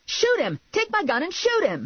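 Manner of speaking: high, frantic order; urgent yelling. A narrator's reading. Driver ordering passenger to fire, yelling. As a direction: cry it out at top pitch. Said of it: "I yelled".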